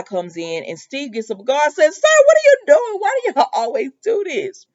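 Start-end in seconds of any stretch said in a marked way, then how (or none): 1.9-4.7 loud high-pitched mock indignation, laughing through it; exasperated outburst. Steve jumps up to confront Eli, exasperated and hammed-up. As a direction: shout it high, cracking up partway.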